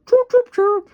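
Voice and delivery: very high, loud chirping; high bird chirps. Acted. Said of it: "chirped Bird".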